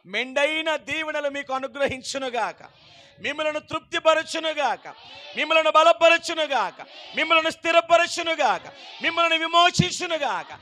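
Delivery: rhythmic blessing; fervent benediction. Acted. Preacher blesses the people, fervent preaching. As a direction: chant each blessing with conviction.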